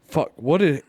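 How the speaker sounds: ordinary delivery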